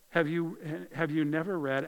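ordinary delivery

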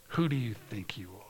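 hissed mock taunt, breathy and low; jeering whisper. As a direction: sneer it under your breath. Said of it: "they jeered at us".

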